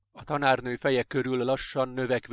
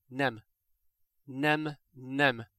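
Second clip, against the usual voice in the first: small, stunned denial with pauses; understated inner whimper; much slower than usual. A narrator's reading. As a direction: whimper it to yourself. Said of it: "agyában szűkölve ismétlődött".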